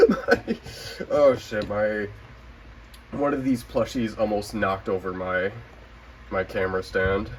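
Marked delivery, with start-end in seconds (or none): none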